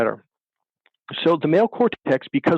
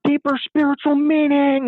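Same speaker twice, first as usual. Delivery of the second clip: retold scream; 12 semitones higher than usual. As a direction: stretch out the imitated exasperated yell. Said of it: "he screams out".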